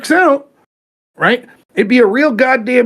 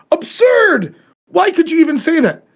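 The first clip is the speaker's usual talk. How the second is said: much higher, scandalised objection; mock outrage; 7 semitones higher than usual. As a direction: play the appalled critic, pitched way up. Mocking opponents' scandalised objection.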